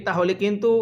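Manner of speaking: ordinary talk, nothing marked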